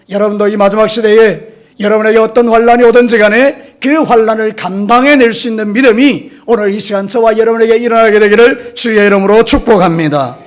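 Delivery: loud, forceful pastoral blessing; preaching blessing. Real, not acted. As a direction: proclaim the blessing loudly over the congregation. Blessing over the congregation at force. (힘차게 선포하는 축복)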